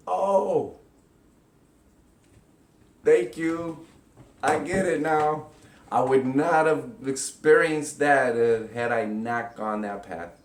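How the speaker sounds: heartfelt thanks, slowed; grateful realization. Pretend. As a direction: gush with sudden gratitude. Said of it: "you'll be like".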